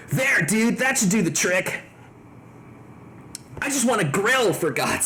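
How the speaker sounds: laid-back surfer dude. Acted. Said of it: "by putting my surfer voice on".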